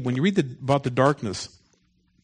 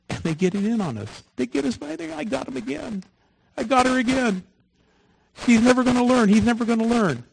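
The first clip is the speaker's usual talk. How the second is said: high, taunting demon voice, getting louder; gloating demons; 10 semitones higher, 3 dB louder than usual. Acted. Preacher mocks demons tricking believers, high and gloating. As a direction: cackle it out like a smug little devil.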